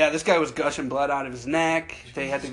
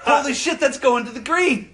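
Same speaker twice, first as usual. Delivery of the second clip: excited; 9 semitones higher than usual. Retold or imitated. Golfer watching his drive head for the green, higher. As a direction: gasp it out as the ball heads for the green. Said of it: "And then I go".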